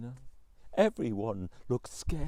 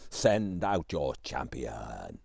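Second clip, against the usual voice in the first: very slow, much lower, rumbling giant voice; deep giant growl; 12 semitones lower, much slower than usual. A narrator's reading. Giant repeating his challenge to the palace, slow low growl. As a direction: drag it out low and menacing. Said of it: "bellowing at the top of his voice".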